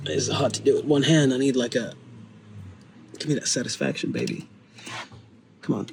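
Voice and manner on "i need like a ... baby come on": ordinary talk, nothing marked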